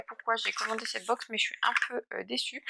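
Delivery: ordinary talk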